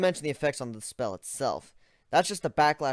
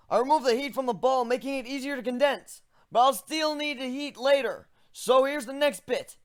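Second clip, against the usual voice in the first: high character voice; 13 semitones higher than usual. Acted. Protagonist explains his spell.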